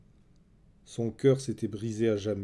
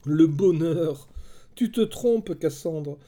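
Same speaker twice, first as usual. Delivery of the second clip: raised-pitch scoff, lightly sneering; scornful; 5 semitones higher, 4 dB louder, slower than usual. A narrator's reading. Helen rejecting Cassandra's talk of happiness, scornful. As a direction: throw the idea back with bitter derision. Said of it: "Hélène eut un ricanement".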